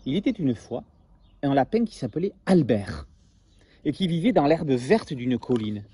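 lively storybook read-aloud; story narration. A narrator's reading. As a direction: tell it like a bedtime tale to children. Reads story to class.

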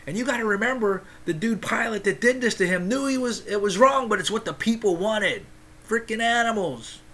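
preacher getting fired up at the cruelty. Real, not acted. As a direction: let the anger about Pilate boil over. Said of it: "Sorry, I get fired up".

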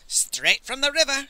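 high-pitched tearful confirmation; hammed-up sobbing answer; mock sob. A narrator's reading. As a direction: lament it in put-on grief. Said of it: "sobbed Master Li".

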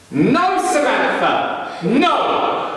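high, drawn-out cry of command; high-pitched retold shout; calling out. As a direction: call off a lion, stretching the words. Man calls out to the lion.

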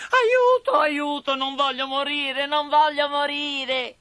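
very high puppet wail; panicked screaming. A narrator's reading. Pinocchio about to be burned, screaming in panic. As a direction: shriek for help in desperate terror. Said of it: "Vedendo Pinocchio urlare e dibattersi".

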